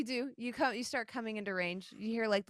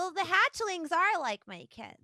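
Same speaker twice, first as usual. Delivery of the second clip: higher-pitched goddess voice; lightly voiced goddess, pitched up; Ahavara; 8 semitones higher than usual. Turtle goddess speaking of her hatchlings, lightly played higher voice. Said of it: "You hear Ahavara say".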